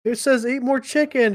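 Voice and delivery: high, loud playful correction; mock insistence. Teller corrects the preschool kid, hammed-up insistence. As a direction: push back like a grown-up losing a silly argument. Adult insisting on the trained joke.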